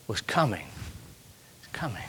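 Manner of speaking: whispered, trailing off. Acted. Preacher whispering that Jesus was coming. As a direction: breathe the words out.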